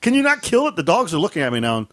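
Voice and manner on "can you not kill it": higher mock wife, exasperated; wife's voice. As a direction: pitch up to play the fed-up wife. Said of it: "She goes".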